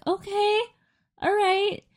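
high, hesitant go-along okay; reluctant okay. Retold or imitated. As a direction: agree without conviction, pitched high. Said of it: "so I was like".

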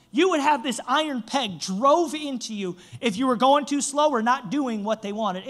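usual speaking voice

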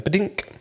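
high, light "ba-dink" blip; imitated notification chime. Telling how achievements popped up mid-game, imitated chime. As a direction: mimic a little pop-up sound.

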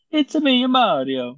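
high, bouncy cartoon plumber voice; Mario. Showing friends the movie's commercial voice, high cartoon impression. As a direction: start high and loud, then drop on the name. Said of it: "he's not trying the Mario voice".

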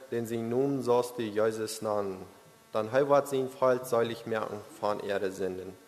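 ordinary talk, nothing marked

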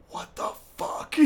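voiceless, stunned whisper; mouthed whisper. Retold or imitated. Missionary mouths to the boys across the chapel, voiceless whisper. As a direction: barely let it out, as if mouthing it across a room. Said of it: "I, I mouth".

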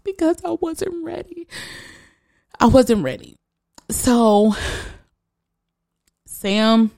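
real high, choked-up voice; fighting back tears while telling it; tearing up. Real, not acted. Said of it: "makes me tear up".

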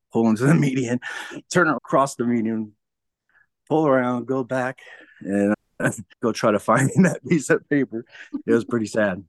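amused, laughing recollection; laughing while telling. Real, not acted. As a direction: tell it through laughs at your own folly. Laughing at own low moment.

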